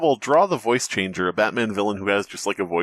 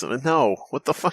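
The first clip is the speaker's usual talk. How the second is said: ordinary talk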